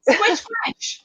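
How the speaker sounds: high squeaky little creature; high-pitched squeak of a small creature; squeaky puppet voice. Acted. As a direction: squeal it out like a tiny toy critter.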